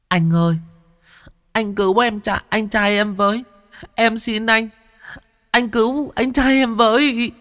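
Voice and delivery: put-on high, tearful child begging; sobbing plea. Ghost child sobbing to Thiên to save his brother, tearful. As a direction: cry and beg in a small child's voice. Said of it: "òa khóc, túm tay thằng Thiên mà nức nở".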